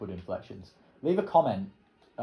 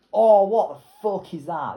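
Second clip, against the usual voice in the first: a loud, drawn-out cry of disbelief; shocked, shouting at the screen; high, loud and slow, caught off guard; startled outburst; 8 semitones higher, 12 dB louder, slower than usual. Real, not acted. Sudden shock at the game map.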